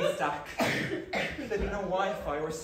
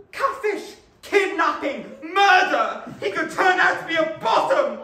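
escalating higher, louder cries of alarm; panicked shouting; 9 semitones higher, 9 dB louder than usual. Acted. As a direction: list each horror as a yelled alarm. Inner voice listing fears, panicking.

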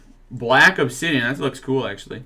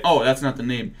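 ordinary talk, nothing marked

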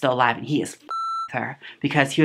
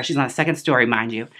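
usual speaking voice

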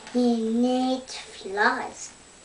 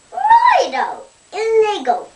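eager; 10 semitones higher, 10 dB louder than usual. Pretend. Dingo eagerly urges.

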